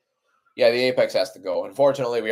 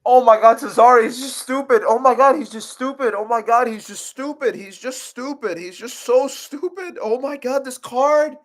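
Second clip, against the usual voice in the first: exasperated high whine; 13 semitones higher, 6 dB louder than usual. Real, not acted. Streamer despairing at a fighter's blunder, exasperated high whine.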